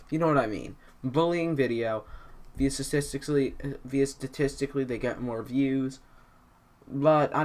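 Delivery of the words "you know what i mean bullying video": ordinary talk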